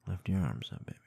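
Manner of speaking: plain reading voice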